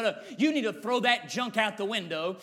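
ordinary delivery

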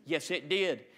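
ordinary delivery

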